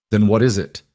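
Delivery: usual speaking voice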